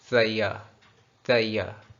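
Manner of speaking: "ज़ैया" as one run-together word; firm, falling "ज़ैया" drill; words mixed together. Shown as a demonstration. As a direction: blend "they are" into "ज़ैया", dropping the r. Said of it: "mix up कर देना है".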